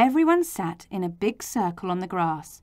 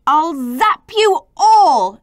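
loud, slow, drawn-out threat; shouted threat; 8 semitones higher, 10 dB louder, slower than usual. A narrator's reading. Z threatening all the other letters, shouting. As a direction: shout it high, stretching the words. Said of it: "shouted Zed".

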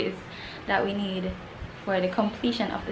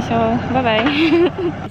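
slow, drawn-out bye-bye; sing-song bye-bye; 4 semitones higher, 9 dB louder, much slower than usual. Real, not acted. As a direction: stretch the bye-bye playfully. Drawn-out goodbye.

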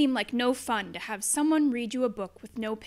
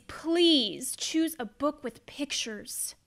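slow pleading; slower than usual. Acted. Pleading with the child.

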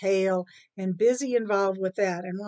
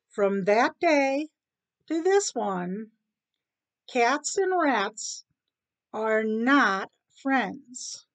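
slow, deliberate concluding narration; storyteller's ending; 4 semitones higher, much slower than usual. A narrator's reading. Storyteller wraps up cat and rat tale, measured. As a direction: draw out each phrase with spaced pauses.

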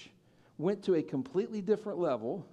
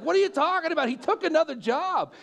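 higher and louder, incredulous; exasperated outburst; 10 semitones higher, 7 dB louder than usual. Retold or imitated. Retelling his disbelieving retort to friend Shane, loud and exasperated. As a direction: throw it back at him in disbelief.

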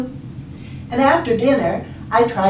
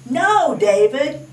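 mock scolding, higher and drawn out; scolding book title; 8 semitones higher, slower than usual. Reader quotes a well-known book title, mock scolding. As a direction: scold it slowly, lightly hammed-up. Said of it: "He wrote the books that said".

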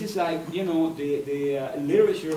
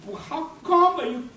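mock disbelief, much higher and louder; incredulous friend; 14 semitones higher, 5 dB louder than usual. Author voicing friends amazed he goes to Tijuana, in mock disbelief. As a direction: play the friend who thinks he's crazy, up high.

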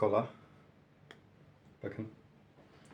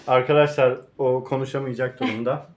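ordinary talk, nothing marked

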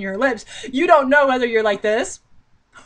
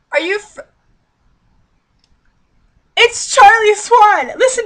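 much higher, louder, slow shouted reveal; excited shout; 10 semitones higher, 8 dB louder than usual. Real, not acted. Reader to viewers, thrilled to spot the father. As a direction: shout the name in thrilled recognition.

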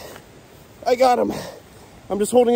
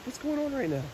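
ordinary talk, nothing marked

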